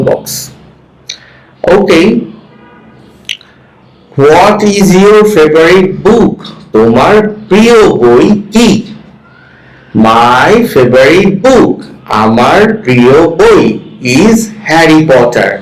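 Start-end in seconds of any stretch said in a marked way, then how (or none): 4.2-6.4 slow model reading. Shown as a demonstration. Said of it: "with proper stress". 10.1-11.8 slow model reading. Shown as a demonstration. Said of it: "with proper stress".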